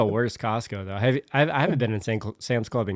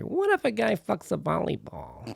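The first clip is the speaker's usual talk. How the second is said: high, wondering movie-director voice; dreamy director voice; 9 semitones higher, 6 dB quieter than usual. Retold or imitated. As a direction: pitch it like a dreamy Hollywood director. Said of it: "Robert Zemeckis being like".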